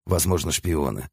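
plain reading voice

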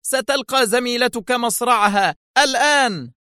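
high, raging threat; utterly enraged. A narrator's reading. Boss dialling to have his colleague killed, raging. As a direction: pronounce it in high-pitched fury. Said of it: "مستطردة بكل ثورة الدنيا".

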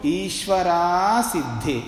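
slow ईश्वरा सिद्धे with the final आ held full; as written. Shown as a demonstration. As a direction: model ईश्वरा सिद्धे slowly for students. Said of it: "जैसा लिखा है वैसे बोलो". (जैसा लिखा वैसा, धीरे ईश्वरा सिद्धे)

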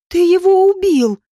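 high, loud cry of accusation; horrified scream. A narrator's reading. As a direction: cry out in horror, voice pitched right up. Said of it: "закричала Женька".

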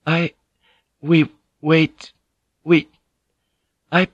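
high, broken-off words with long gaps; shaken faltering. A narrator's reading. Lady forced back from the brink, faltering and shaken. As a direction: struggle to get each word out.

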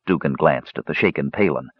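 ordinary delivery, nothing marked

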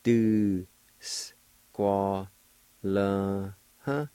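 "Do, s, qua, la, huh" split into beats, "Do" stressed; Cherokee syllables. Shown as a demonstration. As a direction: spell out "Do, s, qua, la, huh" one beat at a time. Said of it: "It's pronounced like this".

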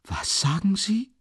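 high, slow, aghast question; mother's frightened cry; horrified. A narrator's reading. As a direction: recoil in shock. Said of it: "rief die Mutter entsetzt".

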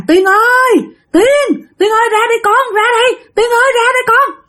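high, frantic, near-tearful calling; in-laws crying out for the daughter-in-law. Retold or imitated. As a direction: call her name in panic. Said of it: "kêu giật ngược luôn các bạn".

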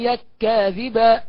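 يك كاذبا run together on one doubled k; merged kaf. Shown as a demonstration. Teacher demonstrates merging, slow doubled k in يك كاذبا. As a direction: hold the joined k of يك كاذبا, slowly. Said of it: "يبقى ندغم".

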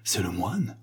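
soft, fearful question; superstitious dread. A narrator's reading. Guests stop laughing and ask, in hushed superstitious dread. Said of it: "dit-on avec une terreur superstitieuse".

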